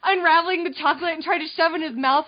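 ordinary delivery

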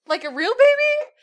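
high, loud incredulous question; mock disbelief. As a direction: react with exaggerated parental shock. Retold incredulous question to son.

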